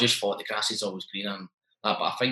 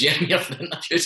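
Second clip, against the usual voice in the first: laughing bluff; 8 semitones higher, 4 dB louder than usual. Retold or imitated. Bluffing at the receptionist.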